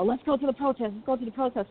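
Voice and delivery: a hint of a teen begging to go; little brother pushing to join the protest, lightly voiced; eager little brother. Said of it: "he's like".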